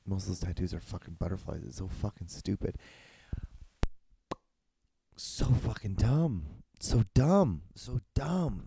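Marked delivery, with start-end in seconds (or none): 5.2-8.6 exasperated rant. Real, not acted. Ranting about butterfly tattoos.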